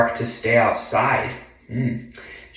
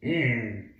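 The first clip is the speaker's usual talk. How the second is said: plain reading voice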